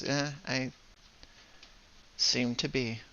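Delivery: soft, resigned sigh of a reply; sighing resignation. Acted. Stranded character answers the apology, sighing and resigned. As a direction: say it softly, as after a sigh. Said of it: "sighs, uh, and goes".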